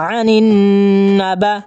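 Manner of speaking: "আনিন না বা" with a long hummed n, then short vowels; chanted nasal hold in "আনিন"; ghunnah nasal hold. Shown as a demonstration. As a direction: linger on the n, keep "না বা" brief. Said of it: "গুণা করে পড়ে নেবেন".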